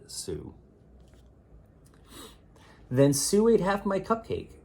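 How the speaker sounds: ordinary delivery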